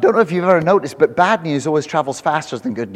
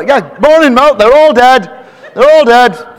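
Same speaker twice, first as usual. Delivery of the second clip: loud, high comic doom cry; gran's announcement; 8 semitones higher, 11 dB louder than usual. Retold or imitated. Gran's morning greeting to grandchild, loud high wail. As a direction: proclaim it as a gloomy granny. Acts gran repeating bad news.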